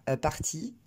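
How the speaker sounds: "a party" in a strong French accent; French-accented "a party", no puff on p or t; accent très français. Shown as a demonstration. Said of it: "L'accent très français va dire". (« a party » avec un fort accent français)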